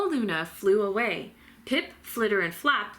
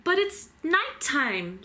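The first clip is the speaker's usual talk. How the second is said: squeaked; 8 semitones higher, slower than usual. A narrator's reading. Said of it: "Stella Luna squeaked".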